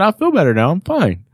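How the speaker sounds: high-pitched retold protest; mock insistence. Patient stopped at the door by doctor, insistent. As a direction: imitate trying to talk your way out.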